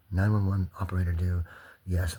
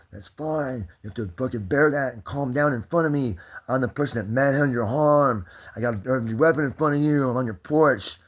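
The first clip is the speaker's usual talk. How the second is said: mock cop voice, higher and building louder; imitating the cop; 6 semitones higher, 8 dB louder than usual. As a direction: ham up a bullying cop getting louder. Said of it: "they're like".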